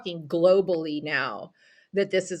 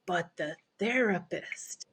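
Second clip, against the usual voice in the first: slow, softer mock Italian accent; trashed Italian accent; 7 dB quieter, slower than usual. Neighbor bringing up the therapist, bad Italian accent. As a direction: ease off, keeping the fake Italian accent. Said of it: "trash an Italian accent".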